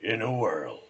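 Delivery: big, loud trailer opening; movie announcer. Acted. Man retrying the trailer catchphrase, epic announcer voice. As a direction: punch it out like a film trailer. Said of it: "Yeah, that's the one".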